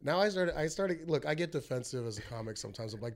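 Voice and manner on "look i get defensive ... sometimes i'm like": ordinary talk, nothing marked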